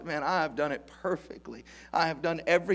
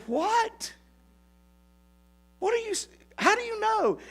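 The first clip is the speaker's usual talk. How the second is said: much higher, astonished squeak; stunned disbelief. Retold or imitated. Assistant pastor learning the pastor knew, astonished. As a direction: blurt it out, caught completely off guard.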